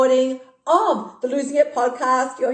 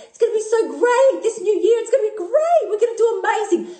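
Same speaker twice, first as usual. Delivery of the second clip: much higher, gushing mock-optimism; mock partygoer; 11 semitones higher than usual. Coach mocking listeners' empty new-year promises, high gushing voice. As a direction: ham up a giddy new-year toast. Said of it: "You go".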